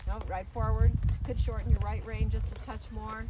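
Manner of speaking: called out across the arena; brisk projected riding commands. Real, not acted. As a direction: project loud instructions to the rider. Instructor calling out to rider.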